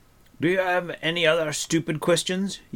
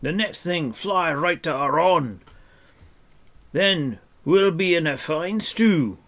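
loud, high, sarcastic tirade; mock their kindness in an exasperated, raised voice; scornful dwarf rant; 9 semitones higher, 7 dB louder than usual. A narrator's reading. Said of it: "he snorted".

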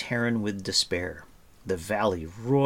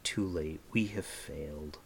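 soft, low, defeated murmur; 4 semitones lower, 9 dB quieter than usual. Acted. Taran realises the warning failed, murmuring in despair. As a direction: let the voice sink in despair. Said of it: "he murmured".

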